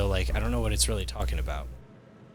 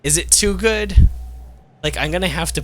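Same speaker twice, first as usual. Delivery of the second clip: excited; 10 semitones higher, 7 dB louder than usual. Real, not acted. Mock worry over strong spell.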